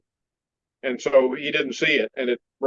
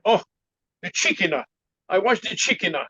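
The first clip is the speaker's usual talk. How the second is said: put-on teacher's Chinese-accented English, extra end vowels; imitated Chinese accent; 3 semitones higher than usual. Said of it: "I like your Chinese accent".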